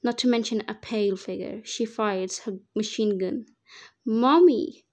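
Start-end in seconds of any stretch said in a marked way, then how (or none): 4.0-4.9 loud, drawn-out call for mommy; child's shout. A narrator's reading. Rescued boy calls to his mother in the gunfight, shouting. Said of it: "Dante shouted in my arms".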